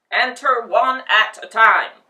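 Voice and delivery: loud, gruff guard's bark; barked order. Acted. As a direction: bark it like a gatekeeper. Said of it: "he barked".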